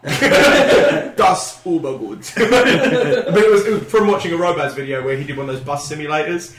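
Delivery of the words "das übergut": very slow, loud mock-German accent; badly faked German, drawn right out; fake German. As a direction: stretch it out in hammed-up German. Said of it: "sound like I'm speaking German".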